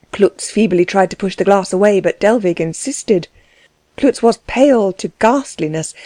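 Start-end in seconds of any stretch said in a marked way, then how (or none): none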